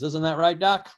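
usual speaking voice

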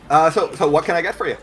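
upbeat tavern keeper taking orders; cheerful barkeep. Acted.

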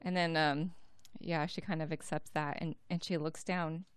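normal talking voice

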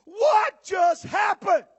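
loud, high, drawn-out disbelief; shocked shout. Acted. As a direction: shout it slowly, stunned. Acts believer thrown back in fire.

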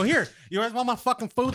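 high-pitched, indignant mock offer; sarcastic offer, pitched well up; retold pissed offer. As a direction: act out a huffy handover. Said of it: "I was fucking pissed".

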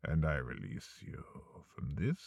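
eerie host signing off, low and quiet; ominous sign-off. Acted. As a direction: release the listener in a low, menacing hush.

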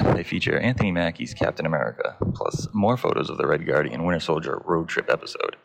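low, even newsreader voice; news read. Acted. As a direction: read the headlines like a news anchor.

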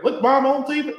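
put-on proud country kid calling out to mom; country accent. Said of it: "went to a country accent".

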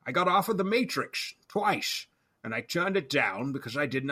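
slurred-s Scottish movie-star impression; hammy amateur Scottish star voice; Sean Connery. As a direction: turn every s into "sh" like an old Scottish star. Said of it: "my best or worst Sean Connery impression".

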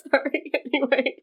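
high laughing apology. Real, not acted. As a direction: laugh through it. Laughing at own story.

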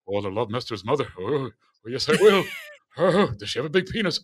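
mock impression of a manic comedian, hooting laughs; Robin Williams. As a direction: riff like a zany comic, breaking into silly hoots. Retold Robin Williams' comic reply.

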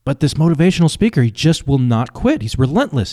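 ordinary delivery

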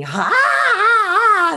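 a sudden high, loud burst of laughter; explosive laugh. Retold or imitated. In a formal meeting, bursting out with an explosive laugh. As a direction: blurt out a shocked laugh. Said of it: "I explode in this very formal meeting".

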